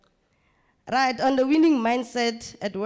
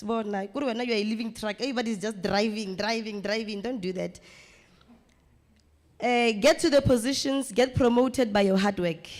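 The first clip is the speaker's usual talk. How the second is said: ordinary delivery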